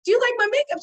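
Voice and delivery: high, loud, hopeful ask; retold eager question. Showing Jerry her makeup at the bus, eager high question.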